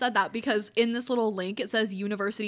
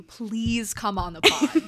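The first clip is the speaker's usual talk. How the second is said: drawn-out plea; 4 dB louder, much slower than usual. Acted. Pleading invitation to Brittany.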